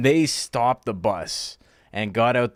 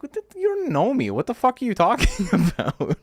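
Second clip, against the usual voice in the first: high-pitched, indignant retort breaking into a laugh; incredulous retort; 7 semitones higher, 4 dB louder than usual. Retold or imitated. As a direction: throw back a baffled, half-laughing protest. Retelling incredulous reply to border guard.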